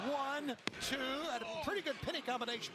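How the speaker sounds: high, fast pin count; excited count. Real, not acted. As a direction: count along in rising excitement.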